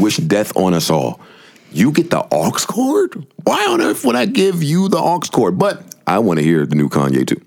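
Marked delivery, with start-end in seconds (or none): 1.7-5.6 outraged disbelief. Retold or imitated. Refusing a friend the aux cord, in mock horror. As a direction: act insulted by the request. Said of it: "curse my entire family".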